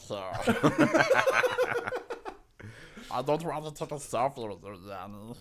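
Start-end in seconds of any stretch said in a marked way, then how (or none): 0.2-2.3 loud laugh. Real, not acted. Loud laugh before talk.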